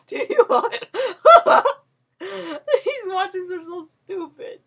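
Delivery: high, loud helpless laughter; can barely speak for laughing; laughing fit. Real, not acted. Gamer in a laughing fit about the washrooms.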